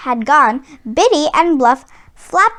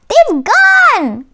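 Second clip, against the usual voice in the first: high, loud alarmed cry; Tweed fakes alarm for Sly; cried out; 10 semitones higher, 5 dB louder than usual. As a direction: call it out as if in shock. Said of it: "cried Tweed".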